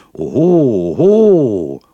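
loud rising oho, oho; painter interrupting. A narrator's reading. Painter interrupts the boastful young man, loud oho. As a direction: cut him off with a drawn-out oho. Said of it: "unterbrach mich der Maler".

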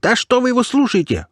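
very high, indignant outcry; shrieky, outraged protest; flaring up. A narrator's reading. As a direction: burst out in a high squeal. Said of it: "взвился хомяк".

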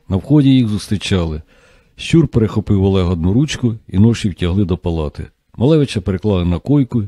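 ordinary delivery, nothing marked

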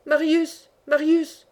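a father calling out for his son, restrained anguish; heart-rending call. A narrator's reading. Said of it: "avec un accent déchirant".

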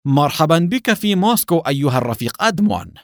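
high, mocking welcome; sarcastic. A narrator's reading. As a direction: greet your captive with gloating irony. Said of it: "وهو يقول بلهجة ساخرة".

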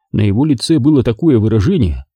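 normal talking voice

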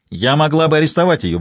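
ordinary delivery

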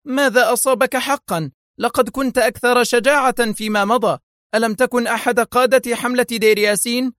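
angry, very high-pitched scolding. A narrator's reading. Wife rebukes her husband's lost courage, angry. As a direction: berate him in a high, indignant voice. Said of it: "صاحت به في غضب".

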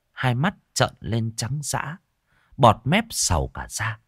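ordinary delivery

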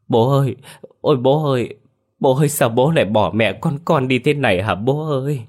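high, loud sobbing cry for his father; wailing. Acted. Khả finds his father dead, wailing high and loud. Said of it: "miệng khóc mếu".

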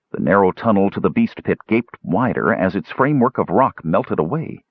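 usual speaking voice

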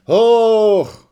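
big high shout of Hoch; a full-throated cheer, falling away at the end. A narrator's reading. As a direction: yell it up to the passing train.

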